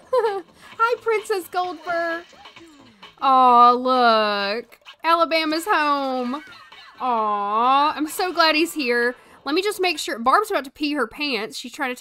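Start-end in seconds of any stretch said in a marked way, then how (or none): 0.1-2.2 higher, slow and doting; pet-talk greeting. Real, not acted. Greeting the returned dog. 3.2-9.1 louder, doting coo; tender aw. Real, not acted. Dog is home.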